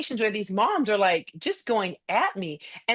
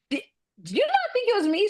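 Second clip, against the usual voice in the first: high, fast incredulous squeak; mock disbelief; 11 semitones higher, faster than usual. Coach mocking her own disbelief at the mom, high fast squeak. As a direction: act floored that she missed it.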